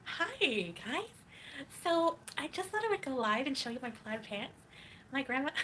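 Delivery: high, loud put-on livestreamer voice; exaggerated high-pitched impersonation; Breezy. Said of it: "Do a breezy voice".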